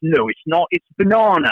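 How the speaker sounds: put-on high-pitched British kid insisting on "banana"; British accent. Son contradicting his sister, high-pitched British accent. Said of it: "my kids all picked up British accents".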